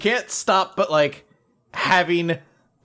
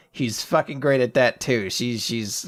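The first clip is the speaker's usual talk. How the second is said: usual speaking voice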